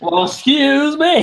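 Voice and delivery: high, slow mock indignation; mock offended. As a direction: drag out the fake offense. Mock offended after "damn.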